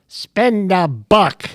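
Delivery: slow, drawn-out sportscaster voice; Howard Cosell. Retold or imitated. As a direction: deliver it like a famous broadcaster. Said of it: "And he goes".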